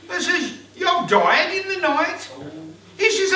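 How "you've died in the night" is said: high, loud and slow through laughter; laughing wife. Acted. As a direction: break the news while laughing. Wife breaks odd news.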